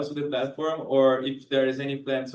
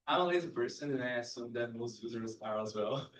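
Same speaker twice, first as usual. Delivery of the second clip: under-projected, fading softer; too quiet; 2 semitones lower, 9 dB quieter than usual. Real, not acted. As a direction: speak softly, barely projecting. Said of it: "Can you speak a little louder?".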